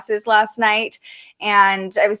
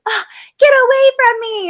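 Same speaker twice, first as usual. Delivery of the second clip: much higher, louder imitated squeal of a kid; mock kid yell; 13 semitones higher, 6 dB louder than usual. Kid pushes away little sister, mock high yell. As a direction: shriek it off like an annoyed big sister.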